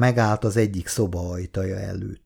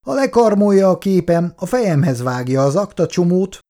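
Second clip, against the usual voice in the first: higher, louder, undeterred; unshaken; 10 semitones higher, 6 dB louder than usual. Acted. Kátai keeps lecturing Irén, unshaken and louder. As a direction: press on regardless. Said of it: "folytatta rendületlenül Kátai".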